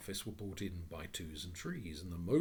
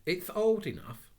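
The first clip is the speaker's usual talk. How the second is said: put-on higher, louder, slow girl's voice; 11 semitones higher, 11 dB louder than usual. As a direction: Anthea pipes up. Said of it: "said Anthea".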